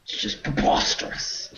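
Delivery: hammed-up British indignation; affronted British gentleman's "preposterous"; mock British proper voice. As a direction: scoff in a put-on British voice. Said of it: "best word to say in that voice".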